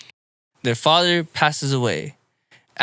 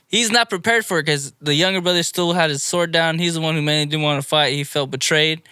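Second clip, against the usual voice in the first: usual speaking voice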